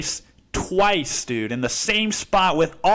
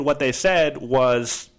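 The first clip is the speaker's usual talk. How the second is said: usual speaking voice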